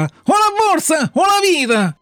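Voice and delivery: high, slow, drawn-out robber shout; robbers' threat. A narrator's reading. Two robbers stop the puppet at night, shouting a threat. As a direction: demand it in long, high cries. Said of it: "to uci che li dissera".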